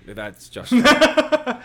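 slow laughing drawl. Real, not acted. Answering the English friend, slow loud drawl. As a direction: drag it out through a laugh.

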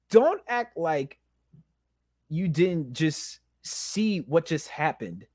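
slow, pointed, broken-up accusation; pissed-off slow repeat. Retold or imitated. Man retelling his argument with God, slow indignant accusation. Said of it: "I'm like, I'm pissed off".

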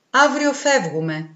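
slow, sad reply. A narrator's reading. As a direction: say it sadly, unhurried. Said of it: "απαντά το κορίτσι με λύπη". (με λύπη, αργά)

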